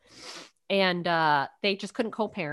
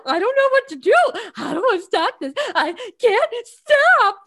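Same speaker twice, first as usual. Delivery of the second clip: high, loud helpless wail, dragged out at the end; hammed-up imitation of uncontrollable sobbing; mock crying; 12 semitones higher, 7 dB louder than usual. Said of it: "I couldn't stop crying".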